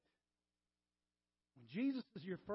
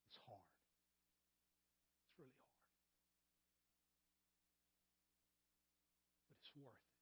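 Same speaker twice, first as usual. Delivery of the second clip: dropped to a near whisper, much softer; hushed; 8 semitones lower, 30 dB quieter than usual. Real, not acted. Pastor quietly confessing to his church, near whisper. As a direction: let it fall to a hush. Sermon drops to hushed close.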